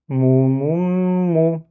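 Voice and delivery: "Mou, mou, mou" with a sustained m, rising on the last; shadda reading. Shown as a demonstration. Teacher repeats the word, drawn-out "Mou, mou, mou". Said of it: "il faut rétracter les lèvres".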